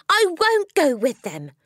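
put-on high, loud childish shout; child shouting out in protest. A narrator's reading. Matilda shouts refusal at Miss Honey. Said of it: "Matilda shouted suddenly".

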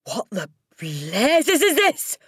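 slow, high, loud roar; outraged yell. A narrator's reading. Headmistress seeing her name on the board, yelling. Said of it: "yelled the Trunchbull".

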